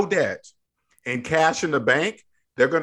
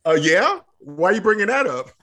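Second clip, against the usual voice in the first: defensive banker; 6 semitones higher, 3 dB louder than usual. Retold or imitated. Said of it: "They're gonna say".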